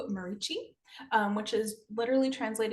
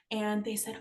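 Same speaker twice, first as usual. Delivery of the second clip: normal talking voice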